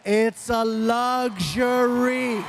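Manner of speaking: very slow, stretched "luxury"; exaggerated shout. Dad lecturing teenager, drawn-out shout on "luxury". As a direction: holler it, dragging out every syllable.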